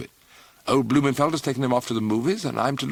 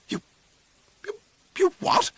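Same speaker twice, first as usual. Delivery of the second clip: stammering, then a much higher strangled cry; spluttering disbelief. A narrator's reading. Bertie on the phone to Miss Wickham, horrified and spluttering. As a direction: choke on it, aghast and breaking high. Bertie aghast at lost dog.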